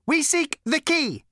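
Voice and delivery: high, lifeless robot rasp; cold robot voice. Acted. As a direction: ancient machine, coldly explaining. Robot repeats itself.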